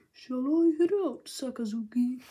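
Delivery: a lightly voiced lazy admiral; Kizaru voice.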